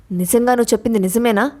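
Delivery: ordinary delivery, nothing marked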